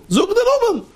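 high, casual call to a parent; cheeky child calling. Retold or imitated. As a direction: mimic a kid ordering a parent about by name. Mimics child using parent's first name.

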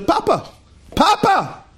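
high, emphatic mock demand; exasperated father's high exclamation; insistent correction. Father demonstrates the demanded title.

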